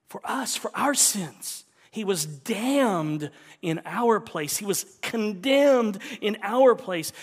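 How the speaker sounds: impassioned sermon climax; preaching at full force. Acted. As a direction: cry it out from the pulpit.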